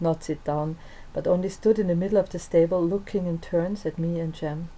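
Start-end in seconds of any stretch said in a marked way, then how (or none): none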